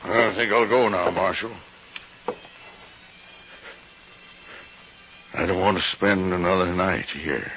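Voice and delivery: urgently wanting out of this place; agitated urgency. Acted. Jim desperate to leave house.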